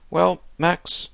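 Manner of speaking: put-on high-pitched old gentleman; old man. A narrator's reading. Old host pursuing his young guest, high and coaxing. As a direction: coax him, pitch well up. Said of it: "my host pursued".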